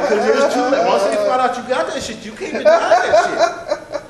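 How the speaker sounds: laughing loud. Real, not acted. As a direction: laugh out the punchline. Laughing emphatic punchline.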